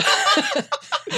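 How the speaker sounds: high squealing laugh; helpless laughter. Real, not acted. Host cracking up at the dance joke, real high laughter.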